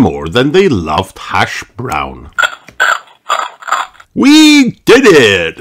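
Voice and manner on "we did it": triumphant cry, high and loud; loud storybook cheer; Super Potato. A narrator's reading. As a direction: cry out a hero's victory. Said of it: "cried Super Potato".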